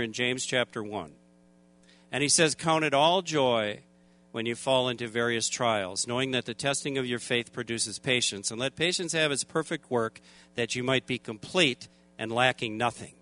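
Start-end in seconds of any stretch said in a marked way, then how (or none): 2.5-13.1 God's voice. Retold or imitated. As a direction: quote God calmly, low and hushed. God speaks scripture to him.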